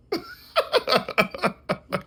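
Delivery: squealing high laugh; high burst of laughter. Real, not acted. Laughing at his own story, high burst of laughter.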